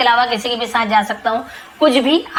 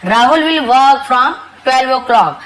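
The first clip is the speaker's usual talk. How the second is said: very slow, spelled-out English example; slow teacher demo; slow model sentence; 3 semitones higher, 4 dB louder, much slower than usual. Shown as a demonstration. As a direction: dictate it slowly to the class. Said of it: "slow model sentence for learners".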